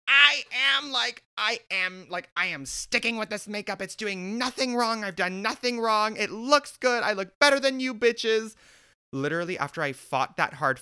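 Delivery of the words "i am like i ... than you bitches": high, loud, insistent declaration; defiant rant. Retold or imitated. Telling coworkers he is keeping the makeup, defiant rant. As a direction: proclaim it loud and high, refusing to budge.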